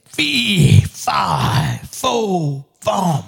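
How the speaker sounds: drawn-out ogre chant with big pitch swoops; mean giant. Acted. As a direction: boom out each word like a storybook giant. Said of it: "big ogre, mean guy".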